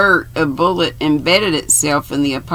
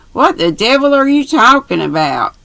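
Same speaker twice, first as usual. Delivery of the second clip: demanding; 8 semitones higher, 5 dB louder than usual. A narrator's reading. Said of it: "demanded Julius".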